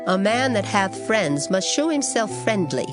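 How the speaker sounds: usual speaking voice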